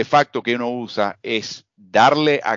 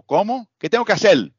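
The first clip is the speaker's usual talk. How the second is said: "hacer" echoed back as "hacel", pitched much higher in mock disbelief; incredulous echo; 10 semitones higher than usual. Interrupting the student to correct his R, pitched much higher and disbelieving. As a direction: toss the mistake back as a puzzled, much higher question. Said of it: "lo interrumpo".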